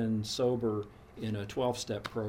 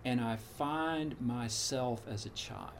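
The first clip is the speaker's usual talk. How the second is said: ordinary talk, nothing marked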